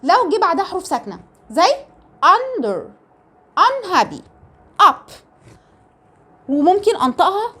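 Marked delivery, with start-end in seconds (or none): 2.2-5.1 "under، unhappy، up" each opening on a short "uh". Shown as a demonstration. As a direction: start "under، unhappy، up" higher, with a brief "uh". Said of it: "امتى انطقها شورت". (نطق "under، unhappy، up" بصوت "أَ" قصير)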